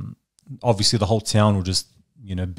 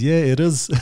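imitating a South African accent, pitched up; higher, mock South African reply; 6 semitones higher, 4 dB louder than usual. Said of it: "Is that a South African accent?".

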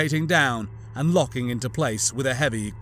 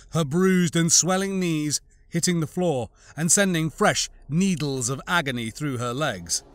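plain reading voice

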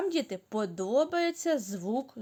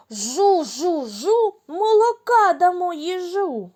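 higher and louder, amazed; surprised; 7 semitones higher, 6 dB louder than usual. Shown as a demonstration. Teacher shows children a surprised chant. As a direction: say it with wide-eyed wonder. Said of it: "А тепер здивовано".

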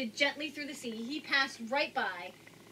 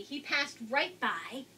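ordinary delivery

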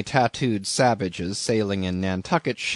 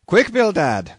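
higher, louder hurry-up call; urgent order; 7 semitones higher, 5 dB louder than usual. A narrator's reading. Captain calling his retreating partner, urgent. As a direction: hurry your partner along.

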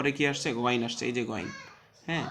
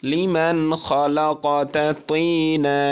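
slow, loud chanted recitation; teacher chanting the verse for students; Quran recitation; 4 semitones higher, 11 dB louder, much slower than usual. Shown as a demonstration. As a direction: draw out each syllable in tajweed tune. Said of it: "পড়তে থাকুন".

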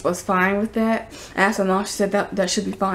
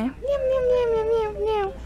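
very high squeaky meowing; silly cat meows; 14 semitones higher, 3 dB quieter than usual. Pretend. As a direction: mew like a tiny cat, way up high. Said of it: "What does the fox say?".

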